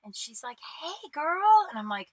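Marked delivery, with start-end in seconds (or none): 0.8-1.7 high, singsong hello; chatty girl greeting. Retold or imitated. Small talk girl greeting her in the bathroom, high friendly imitation. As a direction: imitate an over-friendly acquaintance.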